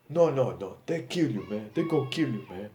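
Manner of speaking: high, loud imitated Nigerian accent. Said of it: "With his Nigerian accent".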